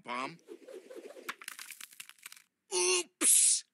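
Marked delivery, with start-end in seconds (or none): 2.7-3.6 high, loud startled oops; surprised oops. Acted. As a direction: yelp it as things fall. Biscuits dropped.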